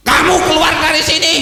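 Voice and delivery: high, fast command; stern angel. Acted. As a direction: order him out like a heavenly guard. Angel orders Samnun out.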